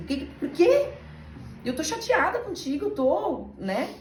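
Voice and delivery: high, pressing retold lament; frustrated complaint. Woman retelling her frustrated prayer, high lament. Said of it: "Eu falei".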